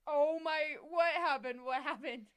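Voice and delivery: loud, high alarmed question. Retold or imitated. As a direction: call out in alarm. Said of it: "I was like".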